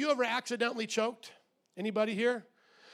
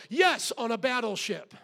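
usual speaking voice

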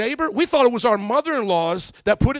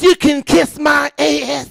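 high, slow, drawn-out sass; mock neighbor defiance; sassy neighbor's insult; 5 semitones higher, slower than usual. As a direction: spell out the insult with exaggerated attitude. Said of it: "You think I can't talk like that in church?".

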